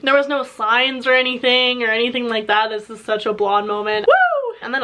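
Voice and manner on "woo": high, sudden playful whoop; woo. Real, not acted. Laughing at her own blonde moment, a high whoop. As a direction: yelp it out, teasing herself.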